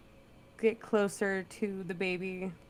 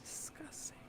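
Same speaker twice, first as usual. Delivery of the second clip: real disgusted whisper; 17 dB quieter than usual. Real, not acted. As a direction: breathe it out in disgust. Reacting to baby melting.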